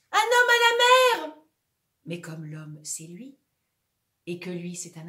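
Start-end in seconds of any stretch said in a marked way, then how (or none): 0.1-1.4 high, loud alarm cry; shout. Acted. Sinking actor crying out man overboard. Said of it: "Il a envie de crier".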